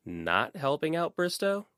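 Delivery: suddenly suspicious, drawn out; probing tone, slowed down; different tone. A narrator's reading. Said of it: "in a completely different tone of voice".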